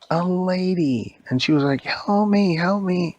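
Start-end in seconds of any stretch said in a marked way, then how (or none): 1.8-3.2 higher, drawn-out plea for help; mock damsel cry. Lady held by Donkey Kong calls out, mock plea. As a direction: call out like a captive damsel in a story.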